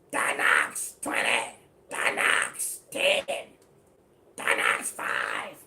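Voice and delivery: loud, slow, voiceless beggar's rasp; imitating Donox. Preacher mimics Donox begging outside the store, loud and voiceless. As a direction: croak out the counts like a broke man on the corner. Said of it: "And he talk like this".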